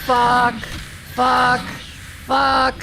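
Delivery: angry swearing. Real, not acted. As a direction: swear hard each time. Repeated frustrated swearing.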